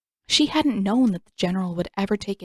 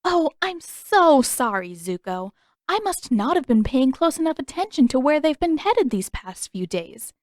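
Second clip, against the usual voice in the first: higher, sugary fake apology dripping sarcasm; mock him sweetly; 5 semitones higher, 4 dB louder than usual. A narrator's reading. Katara mocking the prince with false politeness, sarcastic. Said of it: "let a mocking edge creep into her voice".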